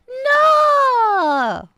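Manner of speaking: high, loud, drawn-out "no"; indignant outcry. Pretend. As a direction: cry out in mock outrage, stretching the word. Mock-indignant denial to chat.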